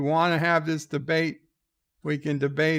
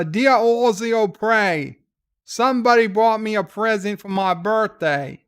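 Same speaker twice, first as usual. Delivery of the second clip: hammed-up Australian accent, pitched up; Ozzy O'Prey; 4 semitones higher, 5 dB louder than usual. Australian cousin reading out the letter, in a put-on Australian accent. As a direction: read aloud in a higher, louder Australian voice. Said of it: "I'm the one that's Australian".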